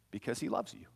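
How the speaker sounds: usual speaking voice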